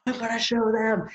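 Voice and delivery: high-pitched, hammed-up indignation; mock angry resolve. Imitating someone firing off an angry email, mock indignant. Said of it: "they were angry about something".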